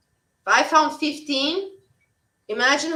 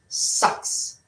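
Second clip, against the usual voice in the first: whispered. Real, not acted. Echoing own complaint about chat.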